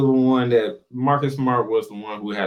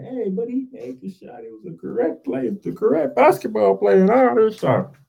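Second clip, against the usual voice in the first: lightly put-on, higher fan voice; mock objecting fans; 6 semitones higher than usual. Host imitating fans who defend the shot, mock objection. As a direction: imitate a defender of the play, pitched up. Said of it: "some of you out there be like".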